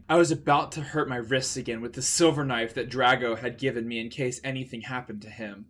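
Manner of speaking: ordinary delivery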